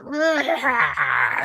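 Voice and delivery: high, drawn-out mock bird screech; imitated hawk screech. Hawk looks back at the speaker, imitated screech. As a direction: screech like a hawk staring someone down. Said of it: "yelling at me and screeching at me".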